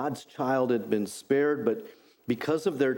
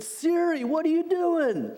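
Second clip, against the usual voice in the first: exasperated yell at Siri. Retold or imitated. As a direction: act out shouting at a wayward GPS. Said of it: "One time I said".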